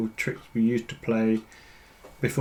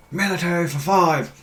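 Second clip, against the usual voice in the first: yelling across the room; 5 semitones higher than usual. Pretend. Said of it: "Or shout across the".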